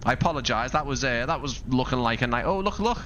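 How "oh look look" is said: much higher, eager call to look; excited spotting. Real, not acted. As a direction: point out the deer with sudden delight. Spots deer during flight.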